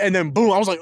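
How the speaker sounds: ordinary delivery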